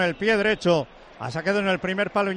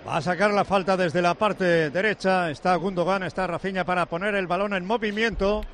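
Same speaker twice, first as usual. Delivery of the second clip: normal talking voice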